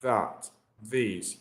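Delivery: "That, these" with the tongue tapping the upper teeth; "That, these" softly, tongue tip on the upper teeth; tapped voiced th. Shown as a demonstration. Teacher modelling the voiced th on "That, these". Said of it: "You're just touching the tip of your upper teeth".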